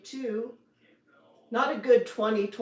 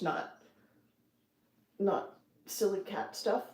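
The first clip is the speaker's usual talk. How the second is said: usual speaking voice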